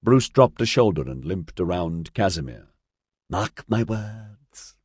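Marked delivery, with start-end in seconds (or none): 3.3-4.8 very slow, lisping menace. Acted. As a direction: lisp each word like a mock prophet. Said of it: "he lisped".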